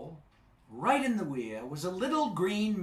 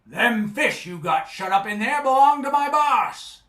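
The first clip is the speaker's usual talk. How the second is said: louder, gruff sea-serpent growl; surly and cross; 7 semitones higher, 9 dB louder than usual. Acted. Sea serpent threatening Charlie at the weir, surly and cross. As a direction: warn him off like a surly bully. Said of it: "surly and cross".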